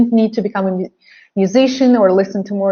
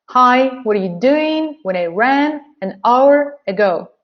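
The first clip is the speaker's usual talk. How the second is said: beats on "Hi", "doing", "ran", "hour"; four stressed beats; 3 semitones higher than usual. Shown as a demonstration. Teacher demonstrates rhythm of content words, four beats. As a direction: lift "doing", "ran", "hour" high, small words weak. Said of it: "So you have four bits".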